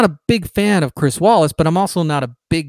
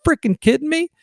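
much higher, scoffing disbelief; incredulous retort; 7 semitones higher than usual. Retold or imitated. Answering leftists who ask if he'll quit, incredulous scoff. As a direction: laugh off the question as absurd. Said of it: "I go".

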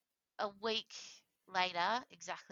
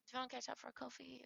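quick, light, high mock invitation; breezy as a coffee invite; casual; 7 semitones higher, 8 dB quieter, much faster than usual. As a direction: imitate an offhand caller, softly. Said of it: "so casual. Like, he was just like".

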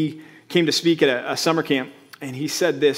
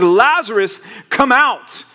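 commanding call; 5 semitones higher, 5 dB louder, slower than usual. Acted. Jesus calling Lazarus from tomb.

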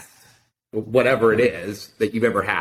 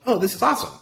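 excited; 13 semitones higher, much faster than usual. Retold or imitated. Excited about a marathon trip.

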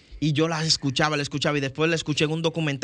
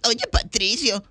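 lightly raised cartoon sponge voice; a hint of SpongeBob calling his friend; 7 semitones higher than usual. Shown as a demonstration. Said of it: "cuando tú escuchas esa voz".

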